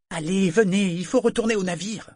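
beaucoup plus aigu, insistant; presse-le de se lever; pressante. Acted. Althea presse Brachen de partir. (Althea presse Brachen de rentrer au navire, d'une voix aiguë)

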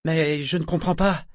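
high, pleading voice; plaintive, imploring plea. A narrator's reading. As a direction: beg to understand. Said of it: "d'un ton implorant".

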